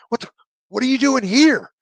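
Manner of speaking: high-pitched mock astonishment, rising hard; astonished demand. Colonel finding his old jump master here, astonished demand. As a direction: imitate a colonel's incredulous challenge. Acted colonel challenging the teller.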